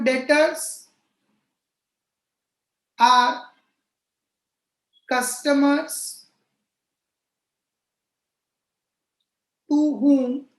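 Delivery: slow, spaced-out dictation with long pauses. Real, not acted. Teacher dictating a definition to students for notebooks, slow dictation. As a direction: dictate phrase by phrase, leaving time to write.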